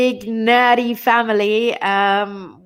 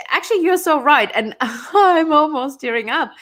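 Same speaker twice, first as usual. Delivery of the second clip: teary laughing; 6 semitones higher, 3 dB louder than usual. Real, not acted. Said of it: "I'm crying".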